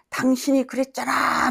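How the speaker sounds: imitating a brother yelling back; brother's accusing yell, retold mildly; retold shout. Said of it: "막 이렇게 소리를 지르는데".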